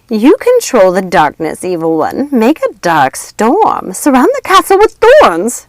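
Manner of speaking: loud, slow, high urging incantation; gloating witch. A narrator's reading. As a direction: egg her on with glee. Lucinda urging Maleficent.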